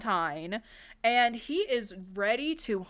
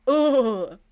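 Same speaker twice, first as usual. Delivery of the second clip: slow, loud groan of disgust; disgusted ugh; 4 semitones higher, 9 dB louder than usual. Real, not acted. Narrator creeped out by the tape, drawn-out ugh. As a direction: shudder it out. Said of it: "it creeps me the hell out".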